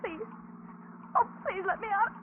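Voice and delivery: high, tearful pleading; desperate begging. Acted. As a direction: plead through rising tears. Captive begs to be let out.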